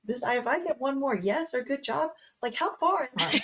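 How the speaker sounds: high exasperated whine; exasperated mock whine. Fed up with the cheering mid-labor, high exasperated whine.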